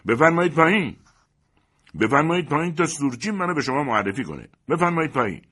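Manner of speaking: high, loud, furious summons; irate yelling up to the guests; angry shouting. A narrator's reading. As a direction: shout it repeatedly in anger. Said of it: "چندین بار با خشم فریاد زد".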